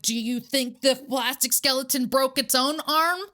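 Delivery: sarcastic grilling of Christopher over the broken arm; retold angry lecture. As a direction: grill him like a prosecutor, outraged. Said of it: "And I was like".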